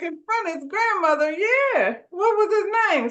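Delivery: hammed-up high Marvin voice; high mock Marvin. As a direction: play him baffled and high. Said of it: "And Marvin was like".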